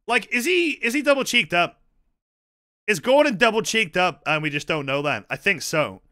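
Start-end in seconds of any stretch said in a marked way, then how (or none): none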